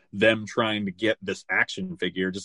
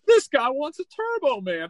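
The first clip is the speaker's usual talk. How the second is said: much higher, hammed-up jeering cry; mocking laughing crowd; 3 dB louder than usual. Imitating store crowd laughing at a shopper, mocking high squeal. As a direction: squeal it in mock hilarity. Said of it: "starts laughing of being like".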